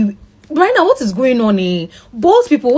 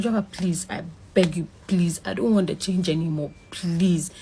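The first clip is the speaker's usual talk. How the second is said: soft, pleading, lowered voice; begging softly; 4 semitones lower, 9 dB quieter than usual. Retold or imitated. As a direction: plead quietly for your life. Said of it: "I was begging him".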